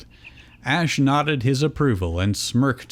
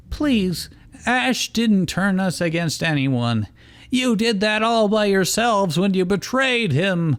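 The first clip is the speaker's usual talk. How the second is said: put-on high, scornful girl voice; scoffing Lopunny; 10 semitones higher than usual. A narrator's reading. Lopunny mocking the traitors in a high scornful voice. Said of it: "Lopunny scoffed".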